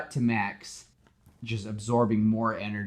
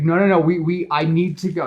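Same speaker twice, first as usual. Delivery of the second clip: higher, louder hurried protest; urgent; 9 semitones higher, 9 dB louder than usual. Retold or imitated. Peter tells Gwen he must leave, higher and louder. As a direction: blurt it, rushing to leave.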